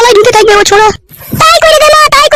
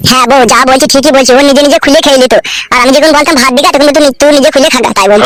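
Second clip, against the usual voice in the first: much lower, put-on man's voice; husband voice; 9 semitones lower than usual. Husband telling new wife about old one, deep voice. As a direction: tell it low as the husband.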